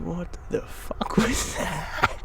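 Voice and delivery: hushed and slowed in disbelief; stunned whisper. Retold or imitated. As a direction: breathe it out, stunned. Stunned retelling of inner voice.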